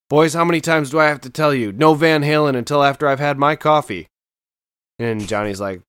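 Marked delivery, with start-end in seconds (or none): 0.1-4.1 quick, a touch louder dad scolding; a hint of a fed-up father; lightly voiced grumpy dad. Dad lamenting sons' music.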